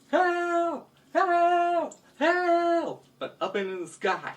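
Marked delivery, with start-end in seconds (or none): 0.1-3.1 high-pitched storybook cries for help; crying for help. Acted. Robbed townspeople call to the sky, high cries for help. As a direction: call out high, in repeated bursts. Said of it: "They cry for help".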